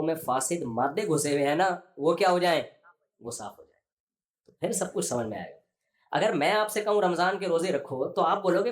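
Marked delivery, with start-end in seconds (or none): none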